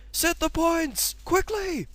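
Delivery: very high, loud urgent command, slow; urgent shout. Acted. Henry ordering the signalman to set the points, urgent shout. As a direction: bellow the order. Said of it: "he shouted".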